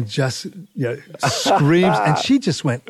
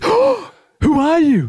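startled gasp; 14 semitones higher, 3 dB louder, slower than usual. Retold or imitated.

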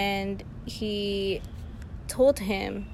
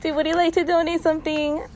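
high, louder sugary pitch; sweet sales voice; 9 semitones higher, 6 dB louder than usual. Shown as a demonstration. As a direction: ask for a donation extra sweetly. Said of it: "It's usually, like, sweet".

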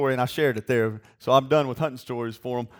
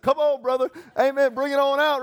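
excited cheer; 9 semitones higher than usual. Retold or imitated. Cheering on the waiter.

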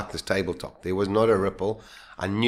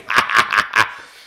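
laughing; 8 dB louder than usual. Retold or imitated. Laugh when surfacing.

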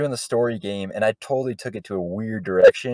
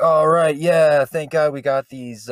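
louder, higher, drawn-out improv line; acted scene opening; 5 semitones higher, 6 dB louder, slower than usual. Improviser re-enacting the scene's first line, hammed-up relief.

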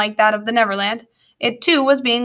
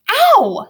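very high, loud "ow"; pained yelp; 13 semitones higher, 6 dB louder than usual. Acted. Prentice struck sideways by Grumpkin, loud pained yelp. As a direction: cry out at a sudden punch.

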